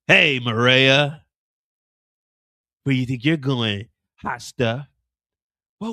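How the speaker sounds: a hitman cornering his target in a parking lot; menacing hitman. Acted. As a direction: drop low and lean on her like a thug. Hitman confronting Mireya.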